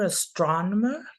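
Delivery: ordinary talk, nothing marked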